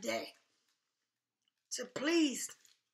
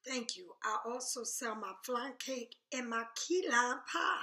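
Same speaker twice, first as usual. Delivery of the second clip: ordinary talk, nothing marked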